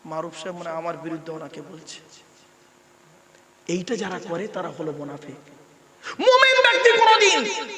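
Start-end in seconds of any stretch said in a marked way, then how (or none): 0.1-2.0 softer, lower gossip of a listener; lightly voiced suspicious man; suspicious muttering. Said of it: "দু একজন ভাববে". 6.2-7.7 high, loud declaration; sermon cry at full force; emphatic proclamation. Acted.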